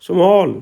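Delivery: "small" as "sa-maal", vowel long and open; teacher demonstrating the vowel in "small"; open back vowel. Shown as a demonstration. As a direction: model "small" with a drawn-out falling vowel. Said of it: "जैसे औ की ध्वनि में".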